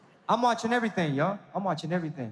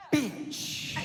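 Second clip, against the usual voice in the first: breathy whispered insult; hissed whisper; 4 semitones higher, 5 dB quieter than usual. Shown as a demonstration. Comic shows wives a sign, whispering bitch. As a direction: whisper it with a sneer. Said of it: "Ladies, pay attention to this one".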